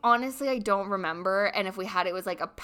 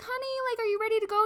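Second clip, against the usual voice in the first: high, sweet mom voice, much higher; imitating his mom; 13 semitones higher, faster than usual. Mom knocking and asking her son to come, in a high sweet voice. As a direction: call through the door like a sugary mom. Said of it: "She's like".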